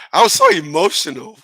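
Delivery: high, hammed-up emotional outburst; acted overemotional. Reenacting the fight with his brother, hammed-up emotional. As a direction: overplay it with a high, rising voice. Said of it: "let me act on it the right way".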